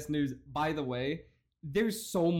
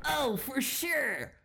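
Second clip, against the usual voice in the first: mock drawl; 8 semitones higher, much slower than usual. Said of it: "for sure it's humbling".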